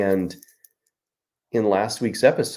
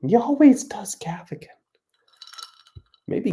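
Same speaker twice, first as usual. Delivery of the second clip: high whispered audience aside; hushed, high heckler's complaint; Jim Gaffigan; 12 semitones higher than usual. Acted. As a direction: whisper it in a thin falsetto. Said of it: "He's doing the Jim Gaffigan thing".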